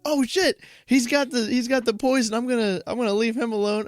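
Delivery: high-pitched comic alarm; high, hurried cartoon fright; alarmed wasp. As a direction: back off in a squeaky mock panic. Wasp spots poison and flees.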